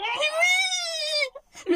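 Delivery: high, slow tearful shout of joy. Real, not acted. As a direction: cry it out with joy. Confirming to sister, crying.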